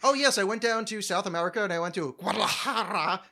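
mock-pretentious Spanish place-name pronunciation; affected Spanish accent. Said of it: "some other language's accent".

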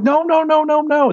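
high, rapid-fire string of denials; frantic backpedaling. Retold or imitated. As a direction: re-enact a panicked attempt to undo a joke. Backpedaling to calm a bawling kid.

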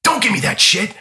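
acted irritated dismissal; mock gruff rebuff. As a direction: imitate a star angrily brushing off a fan. Acted star rebuffing a fan's handshake.

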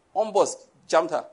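normal talking voice

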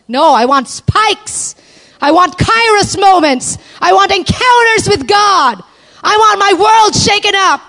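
high, loud impassioned declaration; full-force preaching. Real, not acted. Preacher declaring to women what she wants from God, full-force preaching. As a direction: cry it out to the crowd at full pitch.

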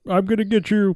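high-pitched put-on threat; mock hero threat. Hero goes after the villain, high mock threat.

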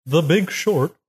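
slow, drawn-out posh announcer. Pretend. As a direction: read the nominees like a posh host. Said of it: "Why are you sounding like a posh?".

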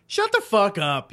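ordinary talk

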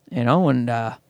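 normal talking voice

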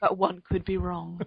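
lightly put-on upper crust British accent; playful mock royal British accent; Queen Elizabeth voice. Host finishing his joke in a mock upper crust British accent. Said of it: "as though you were Queen Elizabeth".

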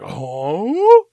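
drawn-out huh swooping up loud; exaggerated, rising mock bafflement; baffled huh. Crew learns the howl wasn't him, mock bafflement.

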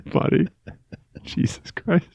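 laughing through the words, fading to a breathy hush; real helpless laughter. Real, not acted. Co-host cracking up at Ian's refusal, real breathless laughing. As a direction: laugh until the voice gives out.

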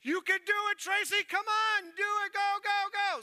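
high, loud, driving cheer; crowd cheering. Acted. Crowd cheering her to a home run, high urgent shouting. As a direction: yell her on like a whole crowd. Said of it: "they're all cheering for her".

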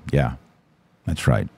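usual speaking voice

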